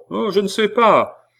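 high, loud voice shouted from outdoors; calling from outside. Acted. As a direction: call it through the door. Hubert answers from outside.

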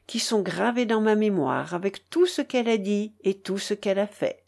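ordinary delivery, nothing marked

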